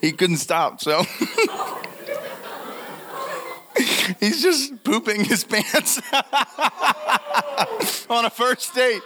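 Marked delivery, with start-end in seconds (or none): 1.0-9.1 laughing through the words, higher; can barely get it out for laughing. Real, not acted. Speaker cracking up telling the audience the date disaster.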